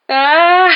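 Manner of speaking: high, very loud rising scream; long frightened "aah" scream; cry of alarm. A narrator's reading. Ron as a branch smashes the door, screaming. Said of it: "s'écria Ron".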